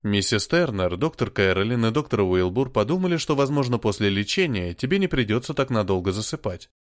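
ordinary delivery, nothing marked

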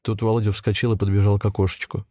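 usual speaking voice

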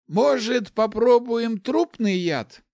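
high, drawn-out character voice; high, slow, thoughtful suggestion; pondering mage. A narrator's reading. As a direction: muse aloud slowly, pitched well up. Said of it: "задумчиво произнёс Ларс".

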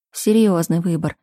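usual speaking voice

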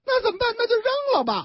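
high, careless squeal; flippant boyfriend. Acted.

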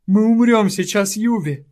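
high, loud panicked babble; panicked gabbling. Acted. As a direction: rattle it off in panic. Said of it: "тараторил он".

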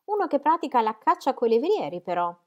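high, loud disdainful objection; disgusted. A narrator's reading. Sir James scorns a man who hunts with greyhounds, disgusted. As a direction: object with lofty distaste. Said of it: "col disgusto di chi pratica".

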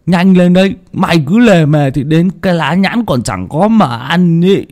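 put-on high, loud boyish nagging; impatient boy. Hưng nagging Tèo to hurry, high impatient boyish voice. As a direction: hurry your slow friend along.

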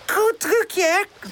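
high, cheeky character voice; high-pitched little rascal; mischievous. A narrator's reading. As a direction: boast cheekily about your prank. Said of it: "zegt Charlie ondeugend".

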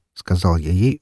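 ordinary delivery, nothing marked

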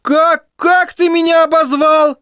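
высокий мальчишеский голос, медленно, с угрозой; будто не веришь своим ушам; зловещий Тедди. Acted. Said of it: "зловеще прошипел Тедди". (Тедди зловеще переспрашивает)

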